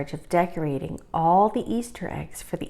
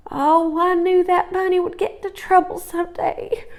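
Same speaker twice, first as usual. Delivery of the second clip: high, lightly voiced tearful mother; a mother close to tears; weeping mother; 11 semitones higher than usual. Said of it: "wept Mama".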